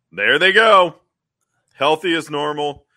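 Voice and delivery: loud chirpy send-off; loud mock cheer. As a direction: call it out loudly, cheerful. Aunt Harriet voiced in recap.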